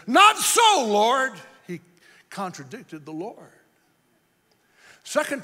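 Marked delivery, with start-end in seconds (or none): 0.1-1.3 high loud shouted refusal; Peter refusing. Acted. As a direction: Peter contradicts the Lord. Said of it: "Peter said".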